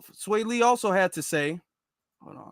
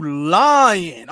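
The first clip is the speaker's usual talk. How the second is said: "lying" loud and drawn out; stress on LYING; 3 semitones lower, 7 dB louder than usual. Acted. Reading out [name]'s angry post to [name], loud stress on "lying". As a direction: stretch out "lying" loudly as if in capitals. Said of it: "all caps".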